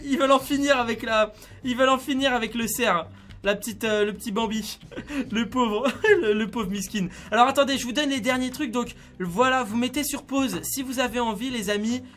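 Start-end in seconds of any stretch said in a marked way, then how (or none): 5.0-7.1 pitying words broken by laughter; amused, laughing as they speak. Real, not acted. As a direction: giggle through mock sympathy. Laughs at battered deer.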